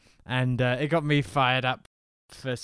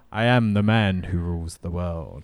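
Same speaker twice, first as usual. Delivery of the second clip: Bond villain; 8 semitones lower than usual. Retold or imitated. Recasting Sid's catchphrase as a Bond villain, suave. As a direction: deliver it like a calm Bond villain. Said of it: "You see, Mister Bond".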